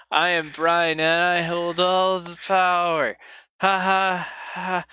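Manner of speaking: loud, high, grandiose proclamation; hammed-up megalomaniac with a spoken villain laugh; mock tyrant. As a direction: declare your power like a cartoon villain, drawn out. Mock boast of total power.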